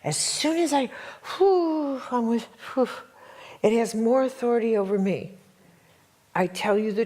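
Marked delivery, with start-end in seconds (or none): 0.2-2.9 high, breathy rush of relief; whew of relief. Retold or imitated. Telling of the rush of trust in the riot, high relief. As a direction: let the relief lift the voice up. Relief surging after fear.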